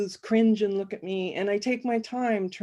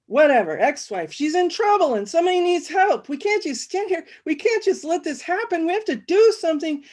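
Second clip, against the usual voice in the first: much higher, louder frantic insisting; panicked pleading; 8 semitones higher, 5 dB louder than usual. Acted. Agitated guy pleading with the narrator to save the woman. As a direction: beg them all to act now.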